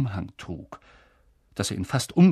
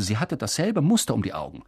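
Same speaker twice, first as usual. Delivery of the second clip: ordinary delivery, nothing marked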